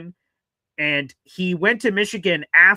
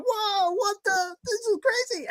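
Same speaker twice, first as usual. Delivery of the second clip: much higher, slow drawn-out amazement; amazed reaction; 4 dB quieter, slower than usual. Retold or imitated. Reading fake trade report, slow high awe. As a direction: stretch the disbelief out high. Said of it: "put it on the screen and go".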